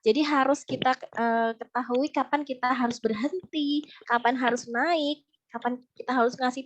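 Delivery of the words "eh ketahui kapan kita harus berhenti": ordinary talk, nothing marked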